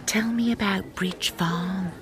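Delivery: hushed, secretive whisper. Acted. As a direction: lean in and whisper the gossip. Hushed gossip at village show.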